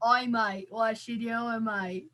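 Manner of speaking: rough-and-ready mock Australian; put-on Australian accent. Shown as a demonstration. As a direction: greet a mate in a hammed-up Aussie accent. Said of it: "Do you want my Australian".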